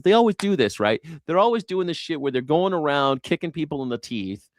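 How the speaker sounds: ordinary talk, nothing marked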